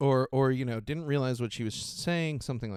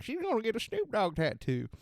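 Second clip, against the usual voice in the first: pitched-up mock of the co-anchor; 10 semitones higher than usual. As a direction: parrot the co-anchor, higher. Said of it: "that's like".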